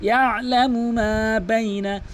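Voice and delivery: slow chanted recitation; Quran recitation. Shown as a demonstration. Teacher recites the corrected phrase, slow chant. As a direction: chant it slowly and evenly.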